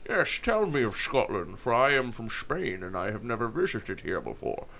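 a lightly voiced Sean Connery line; half-hearted imitation of a Spanish character played as Sean Connery; Sean Connery impression. Friends mocking Sean Connery's Highlander line, lightly voiced Sean Connery. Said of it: "He just talked like Sean Connery".

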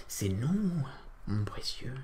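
creepy creature whisper; Gollum voice. Pretend. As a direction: hiss it like Gollum.

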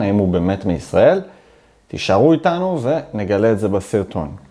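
ordinary talk, nothing marked

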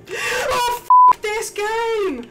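loud, slow exasperated yell; panicking gamer. Real, not acted. Frustrated panic in game.

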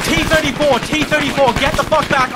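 urgent shouted warning; shouting callout. Real, not acted. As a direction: yell the tank warning to the squad. Tank spotted, urgent callout.

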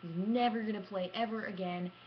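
ordinary talk, nothing marked

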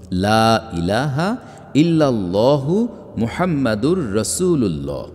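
slow, measured Arabic recitation; stately, drawn-out recital of the kalima. Shown as a demonstration. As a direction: recite the creed slowly, drawing out the long vowels. Reciting the kalima within a reading.

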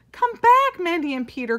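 high, loud call that eases on the names; mother calling her son and goat back. A narrator's reading. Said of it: "called Missus Greenfield".

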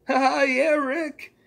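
put-on high nervous boy voice; Morty voice. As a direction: laugh along as an eager kid sidekick. Morty answering Rick.

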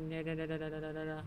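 soft, rapid no no no; backing away, hushed; quiet nervous refusal. Real, not acted. Refusing to go in, scared.

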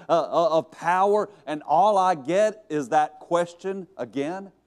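hammed-up complaint to God, ending near a laugh; acted exasperation. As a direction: pile up the grievances in disbelief. Imagined Elijah protesting to God.

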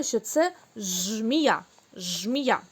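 slow, жмія with zh for z; wrong sound on purpose. Shown as a demonstration. Teacher shows the children the wrong word жмія, slowly. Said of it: "Правильно це?".

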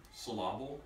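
syllable said as syl-LAH-ble; syllable with LAH stressed; stress on second syllable. Shown as a demonstration. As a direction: move the accent to the middle of syllable. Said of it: "we're gonna emphasize the second syllable".

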